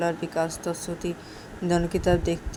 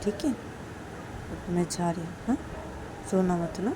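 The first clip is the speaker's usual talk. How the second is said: soft, hushed reassurance; quiet loving comfort; tender apology; 7 dB quieter than usual. Acted. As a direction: soothe gently, barely above a murmur. Siya apologises tenderly after kissing.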